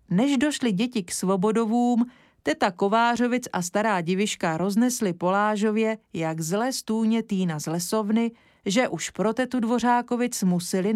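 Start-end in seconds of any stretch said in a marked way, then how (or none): none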